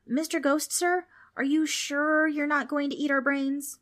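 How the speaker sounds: put-on high-pitched anxious boy; worried boy Frank. A narrator's reading. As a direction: ask pleadingly in a high child's voice. Frank voiced.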